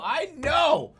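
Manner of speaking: high, loud exasperated squeal; squealed, fed-up insistence; exasperated protest. Real, not acted. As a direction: protest loudly, voice leaping way up. Exasperated protest to being corrected.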